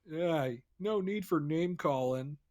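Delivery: hammed-up overly polite Canadian politician; Canadian impression. A player mocks Canadian parliament in a Canadian accent. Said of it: "[name]'s Canadian impression".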